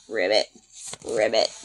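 very low, soft ribbit; frog croak. Acted. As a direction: croak it deep and small like a frog.

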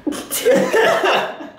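sudden high-pitched laugh. Real, not acted. Reacting to the prank.

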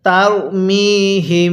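তারমিহিম drawn out slowly through the nose; recitation with ghunnah. Shown as a demonstration. Teacher leading students in nasal recitation, slow. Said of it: "নাকের বাসি দ্বারা গুন্নাহ করবেন".

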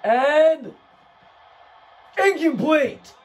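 sudden loud outcry over the play; excited shout. Real, not acted. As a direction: call it out loud, high and thrilled. Reacting to a failed pass.

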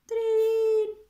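high, loud imitated bell ring; alarm ring. A narrator's reading. Narrator imitating the hare clock's alarm, high ringing call.

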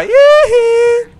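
very high, loud cowboy yee-hee; high gleeful whoop. Real, not acted. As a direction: let out a wild yee-hee of delight. Celebrating goblins dying in fire.

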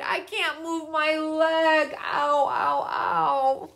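high, slow pained moaning; hurt bear. A narrator's reading. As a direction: whimper through the pain. Injured bear groans.

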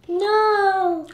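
long, loud cry of protest; loud refusal. Real, not acted. Child answering mother's threat to go, loud protest. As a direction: wail the refusal out, drawn long.

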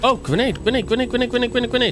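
high rapid panicked warning; urgent repeated alarm; grenade warning yell. Real, not acted.

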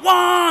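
high revving motor noise; imitated engine whine. Storyteller recalls speeding in left turns, imitated engine whine. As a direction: mimic an engine racing, high and drawn out. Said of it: "We're going".

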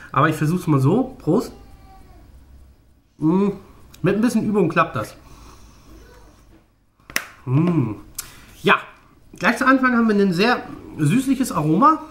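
speaking with fingers at mouth; slightly muffled lip movement; snus pouch under lip. Real, not acted. Seen 0: Holds tin, fingers push pouch into upper lip while speaking.

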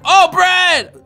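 high loud cry of delight; excited discovery. Real, not acted. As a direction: burst out on spotting it. Spots the bread he seeks.

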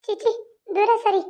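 a frightened village belle complaining about the loafer; village girl voice. Acted. Heroine repulsed, then crying out.

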